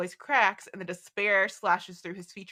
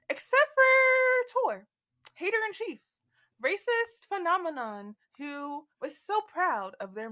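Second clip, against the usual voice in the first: mocking; 9 semitones higher than usual. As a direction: sneer at the villain. Mocking villain Tor.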